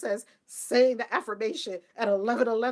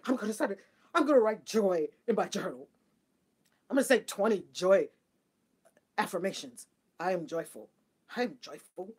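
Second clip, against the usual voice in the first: mock joyless affirmer; 6 semitones lower than usual. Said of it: "That's what you look like".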